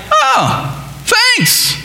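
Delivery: high, offhand oh thanks; mock careless thanks. Shepherds shrugging off the angels' news, offhand. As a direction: brush it off lightly. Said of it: "being like".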